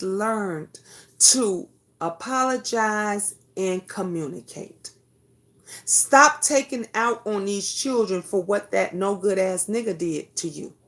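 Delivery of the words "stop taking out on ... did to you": normal talking voice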